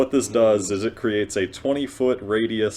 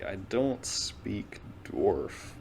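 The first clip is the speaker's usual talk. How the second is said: hushed unvoiced aside; whispering; 7 dB quieter, much slower than usual. Real, not acted. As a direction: whisper it under your breath. Quiet aside at the table.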